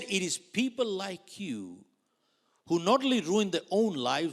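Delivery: loud, high stern lecture; harsh scolding. Retold or imitated. Manager lecturing the agent with a loud stern scolding. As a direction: scold him hard.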